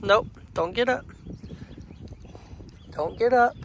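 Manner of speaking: ordinary talk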